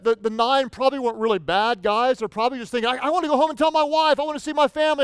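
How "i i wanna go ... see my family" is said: 1 eager rush. Acted. Nine lepers' thoughts voiced.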